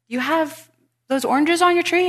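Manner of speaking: high-pitched retold neighbor asking a favor; neighbor's hinting question. Neighbor hints to the speaker about oranges, high mock-casual asking.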